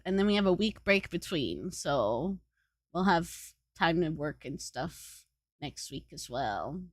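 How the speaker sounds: usual speaking voice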